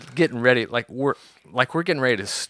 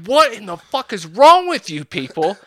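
high, loud exasperated outburst; incredulous yell; 10 semitones higher, 8 dB louder than usual. Retold or imitated. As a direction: holler in disbelief at reckless coworkers. Yelled disbelief amid falling rocks.

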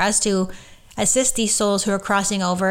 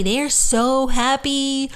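slow, higher and dreamy, drawing out so happy; dreamy blissful; 5 semitones higher, much slower than usual. Retold or imitated. Voicing souls who crossed over, slow dreamy bliss. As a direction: float the words out in bliss. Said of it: "repeated slow".

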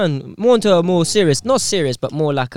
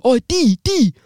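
higher-pitched imitation of a pleading friend; friend calling for help; 6 semitones higher than usual. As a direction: call out to D, mock-helpless. Friend calling from stuck tent.

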